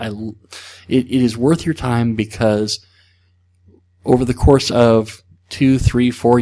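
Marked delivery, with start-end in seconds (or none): none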